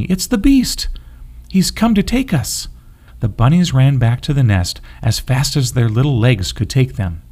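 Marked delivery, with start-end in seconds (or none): 0.0-2.7 much higher, frantic terrified bunny; panicked. A narrator's reading. Bunny warning the others about the beast, terrified. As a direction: cry out in terror that he's coming.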